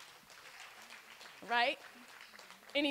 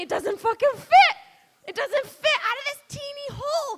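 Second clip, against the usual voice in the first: louder, higher frustrated shout; exasperated yell; 9 semitones higher, 5 dB louder than usual. Acted. Comic ranting about giving birth, exasperated yell. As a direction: blow up at the absurdity.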